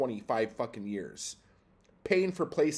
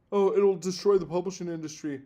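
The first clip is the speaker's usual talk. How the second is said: mocking whine; 8 semitones higher, 6 dB louder, faster than usual. Mocking the industry's claim.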